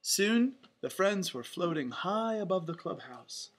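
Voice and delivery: plain reading voice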